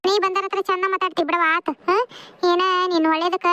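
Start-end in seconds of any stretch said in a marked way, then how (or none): none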